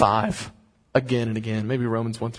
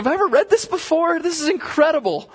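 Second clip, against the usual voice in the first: much higher, fast astonished exclamation; amazed disbelief; 4 dB louder than usual. Retold or imitated. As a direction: gush in wonder at what you just found. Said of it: "I was like".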